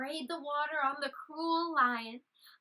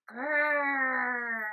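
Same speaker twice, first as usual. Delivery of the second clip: scary lion growl. Shown as a demonstration. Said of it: "Let's be that scary lion".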